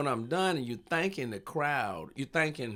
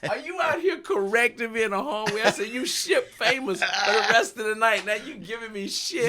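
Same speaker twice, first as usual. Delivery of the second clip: higher, louder fake outrage; mock indignation; 9 semitones higher, 7 dB louder than usual. Comedian scolding the kid for correcting him, in higher mock outrage. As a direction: play offended at a child.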